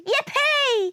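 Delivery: loud, very high cheer; gleeful whoop. A narrator's reading. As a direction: whoop with delight. Planets cheering as they zoom.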